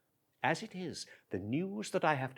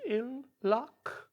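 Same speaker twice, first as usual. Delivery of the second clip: higher, pouncing on the words Ill luck; eager question; 12 semitones higher than usual. Acted. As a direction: grab at Ill luck hungrily. Shylock seizes on news.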